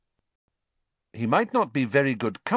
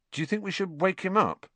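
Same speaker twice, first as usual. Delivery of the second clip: awkward, higher-pitched question; 11 semitones higher, faster than usual. A narrator's reading. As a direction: ask uncomfortably, pitched up. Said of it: "Ron asked awkwardly".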